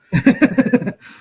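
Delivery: loud, high burst of laughter; real laugh. Real, not acted. Amused at colouring on stream, loud high laugh.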